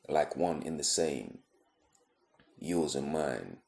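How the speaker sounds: spoken-word poem, measured phrases with long pauses; deliberate spoken-word delivery; poetry recitation. Acted. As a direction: recite it line by line, letting each phrase fall.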